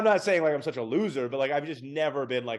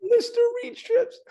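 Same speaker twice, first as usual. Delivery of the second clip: much higher, slow, loud put-on squeak; mock squeaky echo; 6 dB louder, slower than usual. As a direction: stretch it out in a silly high voice. Echoes the joke in a squeaky voice.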